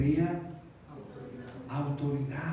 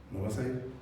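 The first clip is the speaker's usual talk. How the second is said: low, soft, controlled no; calm refusal; 7 semitones lower, 6 dB quieter, faster than usual. Acted. Father refusing his child's plea, calm. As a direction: hold the line quietly.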